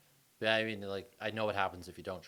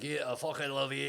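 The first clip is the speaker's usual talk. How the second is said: harsh Scottish growl of affection, higher pitched; Scottish accent; 5 semitones higher than usual. Acted. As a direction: say it like a menacing Scot. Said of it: "no matter what a Scottish person says".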